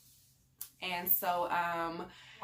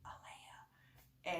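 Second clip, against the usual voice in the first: whispered name; 11 dB quieter, much faster than usual. Retold or imitated. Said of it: "I went down the hallway and I was like".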